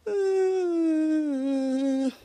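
long held "mm" as if deciding; drawn-out pondering hum. Retold or imitated. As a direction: hum at length before answering, play-acted. Bianca considers the date offer.

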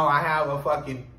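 ordinary talk, nothing marked

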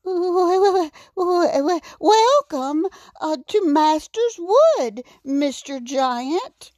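high, stammering little raccoon; nervous stutter. A narrator's reading. Raccoon greets the giant who grabbed him, stuttering nervously. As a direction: stutter on the first sounds, pitched well up. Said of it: "Adam stuttered".

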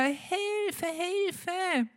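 very high, despairing calls; desperate cry for help. A narrator's reading. Trapped boy calls again for help, very high and desperate. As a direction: call out again, losing hope. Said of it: "völlig verzweifelt und ruft wieder".

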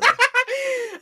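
high squeaky played-up giggle; squeaky gleeful gremlin giggle. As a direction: keep the gremlin giggle going. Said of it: "You sound like a gremlin".

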